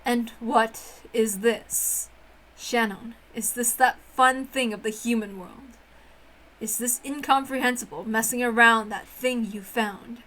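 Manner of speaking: low, haughty witch voice disdaining human games; a proud witch sneering at childish fun; scornful witch. Acted. Witch Beatrice sneering at human children's games, low and haughty.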